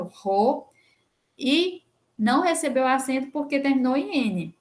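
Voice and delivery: ordinary talk, nothing marked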